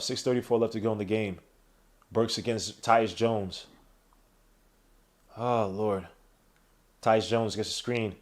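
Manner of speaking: sports announcer calling the game; live play-by-play. Acted. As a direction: call it like a broadcast announcer.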